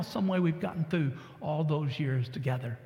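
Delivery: ordinary delivery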